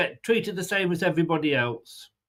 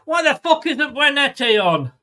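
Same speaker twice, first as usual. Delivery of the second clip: higher and louder, fed-up fan; frustrated outburst; 6 semitones higher, 4 dB louder than usual. Real, not acted. Fan raging at the manager's choices, frustrated outburst. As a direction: spit it out in disbelief at the manager. Fan furious at missing substitution.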